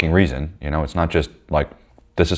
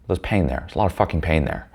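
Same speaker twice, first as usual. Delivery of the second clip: ordinary delivery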